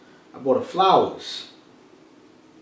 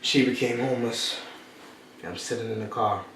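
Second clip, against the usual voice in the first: normal talking voice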